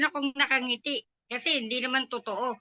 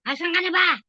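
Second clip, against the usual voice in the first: louder, higher calling out; retold call out; 6 semitones higher, 6 dB louder than usual. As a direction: call across the house, impatient. Aunt calling for her, retold. (tawag nang malakas at mataas, gayang-gaya ang tiyahin)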